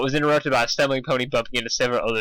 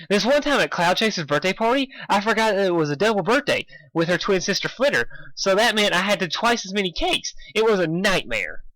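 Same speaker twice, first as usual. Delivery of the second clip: Pinkie voice; 6 semitones higher than usual. A narrator's reading.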